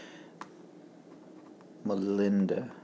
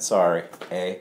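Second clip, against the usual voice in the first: put-on Canadian accent; 6 semitones lower, 6 dB quieter than usual. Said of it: "I have to say it with a Canadian accent".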